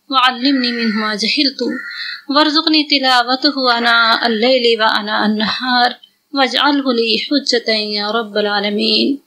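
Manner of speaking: ordinary delivery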